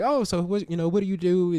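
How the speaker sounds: high, bright curious questioning; curious small talk. Retold or imitated. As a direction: play the nosy stranger asking. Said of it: "it's fun to be like".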